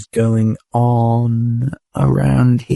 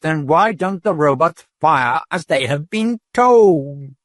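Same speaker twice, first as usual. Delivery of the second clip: much higher, slow and shrill; frightened questioning; 7 semitones higher than usual. A narrator's reading. Dodath frightened, questioning the patrol robot. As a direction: press the robot, scared.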